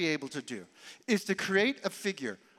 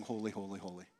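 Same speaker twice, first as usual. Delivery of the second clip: much softer, lower, almost breathed awe; hushed reverent holy; 12 semitones lower, 11 dB quieter, faster than usual. Shown as a demonstration. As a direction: drop to a hushed hymn in awe of God. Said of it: "and sing".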